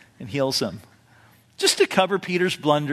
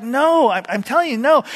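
higher, louder flustered denial; insistent denial; 8 semitones higher, 4 dB louder than usual. Retold or imitated. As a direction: push back hard. Peter denies again.

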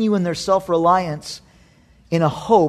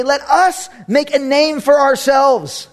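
slow proud quote; 8 semitones higher, 3 dB louder, slower than usual. Acted. Quoting the tower builders.

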